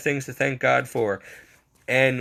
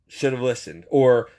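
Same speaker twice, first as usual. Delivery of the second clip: usual speaking voice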